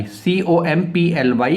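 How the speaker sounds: ordinary delivery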